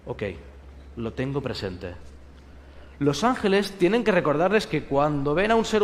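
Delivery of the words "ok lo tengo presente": low, soft, matter-of-fact; quiet acknowledgment. Acted. The angel answering its orders, low and soft. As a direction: mumble it under your breath.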